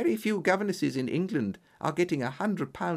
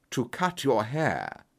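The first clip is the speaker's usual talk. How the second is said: lower, slow, incredulous echo; frowning surprise; 5 semitones lower, slower than usual. A narrator's reading. Holmes echoes the strange request, slow and incredulous. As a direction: repeat it as if doubting your ears.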